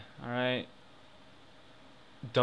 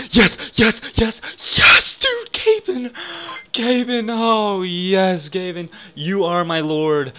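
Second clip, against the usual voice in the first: very high, loud screaming yes; real ecstatic yelling after the win; 7 semitones higher, 9 dB louder, slower than usual. Real, not acted. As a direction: shriek in triumph, then slow worship. Big win celebration.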